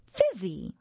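lightly voiced excited toddler; delighted toddler. A narrator's reading. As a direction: exclaim like a charmed small child. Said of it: "sharp-clawed monster and said".

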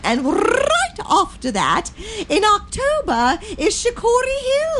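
climbing storybook granny sing-song; Mother Goose voice. Pretend. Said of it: "you have to do it in Mother Goose's voice".

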